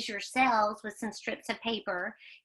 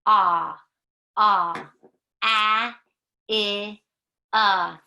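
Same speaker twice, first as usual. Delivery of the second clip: loud short vowel chant, much louder; alien talk; 7 dB louder, much slower than usual. Pretend.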